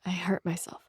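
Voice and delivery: whispered, ashamed admission; quietly. Acted. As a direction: barely breathe the words. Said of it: "I said quietly".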